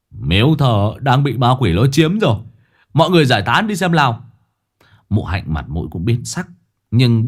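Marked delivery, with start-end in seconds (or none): 0.1-4.2 speaking loudly. Acted. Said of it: "Tứ Béo liền nói to".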